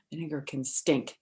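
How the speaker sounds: ordinary delivery, nothing marked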